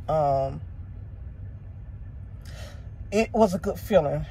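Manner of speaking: fighting back real emotion; getting emotional. Real, not acted. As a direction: voice softening as tears come. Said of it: "I'm getting emotional now talking about it".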